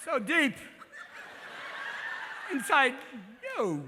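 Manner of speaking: clipped Walken cadence with strange breaks; deadpan eerie seduction; Christopher Walken. Retold or imitated. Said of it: "Chris would be like".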